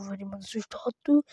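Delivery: unvoiced soft aside; hushed outro plea; whisper. Real, not acted. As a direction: whisper the reminder to viewers. Soft sign-off to viewers.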